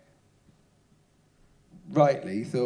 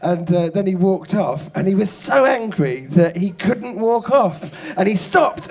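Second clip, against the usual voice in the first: ordinary delivery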